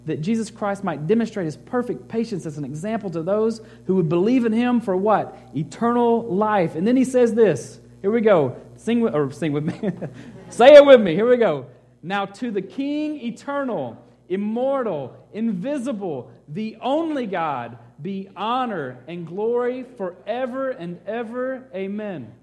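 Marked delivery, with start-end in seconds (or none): 0.1-6.7 higher and louder scripture reading; excited reading. Retold or imitated. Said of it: "with excitement and enthusiasm". 10.5-11.7 loud, high call to the crowd; rally the room to read along. Real, not acted. Pastor calling the congregation to join in, loud shout. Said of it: "calling congregation to join". 12.0-22.3 higher, slow, stately proclamation; enthusiastic reading. Acted. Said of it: "with excitement and enthusiasm".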